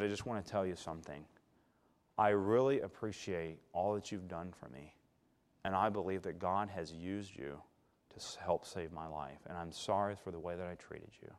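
quiet, humble, sincere; soft sincere apology. Retold or imitated. Patient apologizing softly to his nurse, humble and sincere. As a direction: say sorry gently and from the heart.

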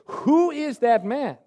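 high, loud, slow enraged outburst; furious king. Acted. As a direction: roar it out as the outraged king. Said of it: "he was filled with rage".

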